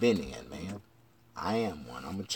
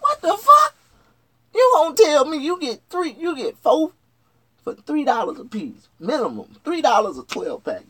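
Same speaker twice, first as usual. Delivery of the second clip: high, loud indignant yell; shouted outrage that cools down; outraged rant; 14 semitones higher, 7 dB louder than usual. Retold or imitated. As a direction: rant at the cashier in disbelief. Outraged at register.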